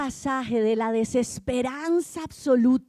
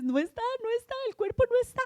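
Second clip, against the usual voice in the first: high, frantic cry of alarm; 10 semitones higher, much faster than usual. Acted. María running back to the apostles, panicked high cry. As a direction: blurt it out in a panic, high-pitched. Magdalena panics at the empty tomb.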